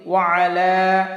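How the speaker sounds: ওয়া আলা as two words, a clear break before আলা; separate wa and ala. Shown as a demonstration. Teacher shows students the correct split ওয়া আলা. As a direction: keep ওয়া and আলা apart, do not merge into ওয়ালা. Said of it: "এখানে আমরা ওয়ালা বলবো না".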